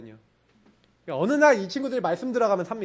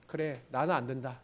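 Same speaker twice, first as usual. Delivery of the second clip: much softer and lower, giving up; defeated murmur; 10 semitones lower, 13 dB quieter than usual. Retold or imitated. Student after a bad mock exam score, defeated murmur. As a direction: sink into it, barely audible.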